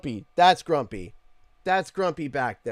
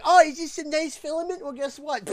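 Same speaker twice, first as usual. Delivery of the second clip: high, mocking printer voice; Grumpy printer voice; 14 semitones higher, 8 dB louder than usual. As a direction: sneer it as the nasty printer. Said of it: "Grumpy will just be like".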